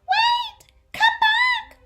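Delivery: very high, loud call; piercing frantic shout; desperate yell. A narrator's reading. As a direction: yell after someone running away. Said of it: "yelled Mama Fin".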